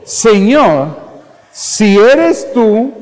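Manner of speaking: high, slow, challenging call; daring challenge. Acted. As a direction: dare the Lord to prove it. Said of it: "lo retó". (Pedro retando al Señor, en tono desafiante)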